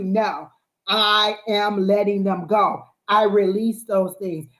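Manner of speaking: slow, deliberate declaration; firm affirmation. Shown as a demonstration. Coaching a client to release, slow firm affirmation. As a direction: state it like it is already done. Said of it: "Not "I want to".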